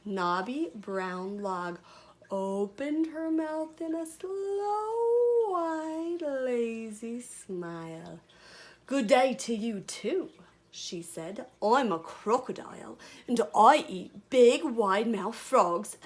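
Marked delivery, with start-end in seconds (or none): 2.3-8.2 stretched-out, higher narration; slow, lazy storytelling, gliding back down; slow drawl. A narrator's reading. Narrator acts out slow lazy smile. 8.9-10.3 slow, loud Australian crocodile; drawn-out Australian croc voice, higher; attempted Australian accent. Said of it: "I tried to do my Australian accent". 11.6-16.0 slow, lightly Australian crocodile; loud, deliberate words with an Australian touch; attempted Australian accent. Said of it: "I tried to do my Australian accent".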